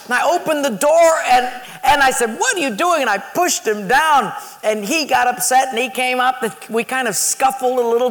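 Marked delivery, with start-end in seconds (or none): 2.4-3.0 high-pitched retold confrontation; indignant outburst. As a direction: demand it of the prankster, outraged. Retold confrontation after flood.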